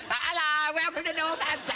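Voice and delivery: high, laughing welcome voice; mock-cheery Northampton greeting; mock greeter. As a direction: greet like a cheesy town greeter. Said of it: "everyone in Northampton".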